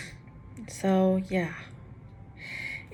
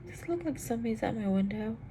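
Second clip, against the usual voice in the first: hushed, fast spooked whisper; 3 semitones higher, 7 dB quieter, faster than usual. Real, not acted. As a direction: whisper it, uneasy and quick. Spooked by something at window.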